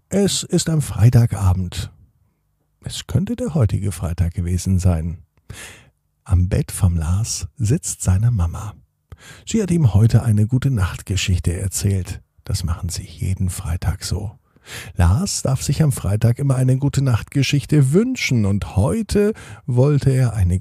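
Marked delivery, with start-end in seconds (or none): none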